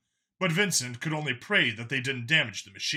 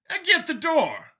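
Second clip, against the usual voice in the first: higher, louder voice for Brian; Brian's voice; 6 dB louder than usual. Acted. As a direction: snap out a quick instruction. Brian's voice, high.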